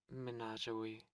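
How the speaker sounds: normal talking voice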